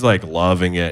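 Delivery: usual speaking voice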